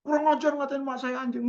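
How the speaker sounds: high, indignant comeback; mock offended retort. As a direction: play the insulted man snapping back.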